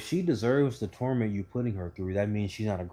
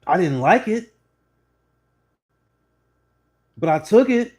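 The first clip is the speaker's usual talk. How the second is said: high and loud, building; loud, rising admission; loud punchline; 6 semitones higher, 9 dB louder than usual. Real, not acted. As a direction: land the punchline with force.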